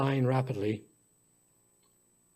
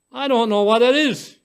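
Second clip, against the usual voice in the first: put-on high, louder little girl; innocent child; 7 semitones higher, 6 dB louder than usual. A narrator's reading.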